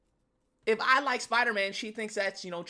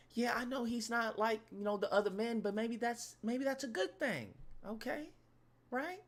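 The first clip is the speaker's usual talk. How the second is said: put-on smitten girlfriend; 3 semitones higher, 7 dB quieter than usual. Woman defending her man to a girlfriend, hopeful. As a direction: plead his case softly, seeking agreement. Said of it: "She's talking crazy about this dude".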